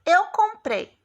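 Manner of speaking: "Eu comprei" high and loud, then held open; announcing a list. Shown as a demonstration. Teacher giving a colon example to pupils, high announcing tone. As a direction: set up "Eu comprei" for what follows. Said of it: "eu vou falar assim".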